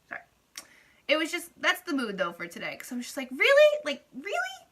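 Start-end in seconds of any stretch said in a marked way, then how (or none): 3.3-4.7 much higher, disbelieving "really"; incredulous. Retold or imitated. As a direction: squeak it out in total disbelief. Disbelief at second complaint.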